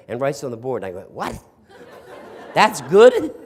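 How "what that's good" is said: high-pitched, hammed-up disbelief; incredulous. Sarcastic student hears the teacher say very good, acted disbelief. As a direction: burst out baffled, rising to a loud question.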